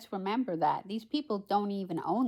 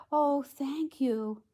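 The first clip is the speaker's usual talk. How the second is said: slow, higher storybook thanks; lightly voiced, lingering princess; grateful Cinderella; 6 semitones higher, much slower than usual. A narrator's reading. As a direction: draw out the thanks as the heroine. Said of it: "said Cinderella".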